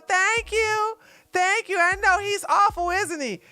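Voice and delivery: high sweet imitation of the first lady; a light, cheery first-lady voice; mock Jill Biden. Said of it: "She go".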